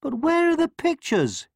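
put-on very high childish astonishment; astonished little prince; surprised. A narrator's reading. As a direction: ask in high-pitched surprise. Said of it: "he asked in surprise".

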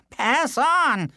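panicked dismissal; frightened villager. Acted. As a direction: shoo him off fast. Villagers flee.

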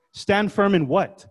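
ordinary talk

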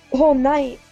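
ordinary delivery

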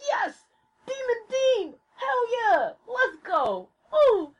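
high-pitched, giddy celebration; reenacted ecstatic cheer; overjoyed fan cheering. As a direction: squeal it like your wish just came true. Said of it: "who was fucking happy about".

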